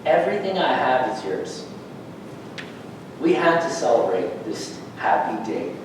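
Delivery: low, measured, gentle fatherly reply; a hint of a tender, patient father; lightly voiced father. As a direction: reassure a son, slowing right down at the end. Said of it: "His father said to him".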